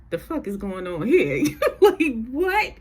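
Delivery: high incredulous laugh through the words; laughing disbelief. Retold or imitated. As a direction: laugh out a baffled "what?". Reacting to sickening couple on TV.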